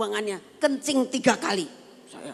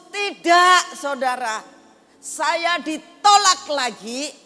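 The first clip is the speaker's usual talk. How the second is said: loud, high revival-pulpit thanksgiving; preaching at full force; 5 semitones higher, 8 dB louder than usual. Acted. Preacher thundering thanks to God before the congregation. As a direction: build the congregation up with fervent loud praise.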